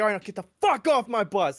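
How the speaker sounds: loud, high bark; screaming drill sergeant. Retold or imitated. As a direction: scream the order. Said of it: "He just starts screaming at you".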